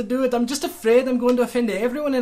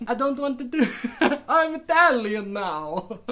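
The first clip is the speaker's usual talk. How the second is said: giggling through the outtake; laughing; 4 semitones higher than usual. Real, not acted. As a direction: crack up mid-sentence. Laughing outtake after failed accent.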